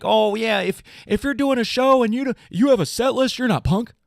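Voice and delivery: high whiny mock-punk sneer; high snotty impression of rule-bound punks.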